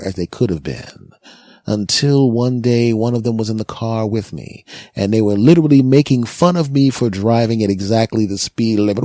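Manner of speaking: usual speaking voice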